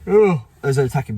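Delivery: real yawn dragging through the words. Real, not acted. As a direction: talk through a morning yawn. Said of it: "sorry, I keep yawning".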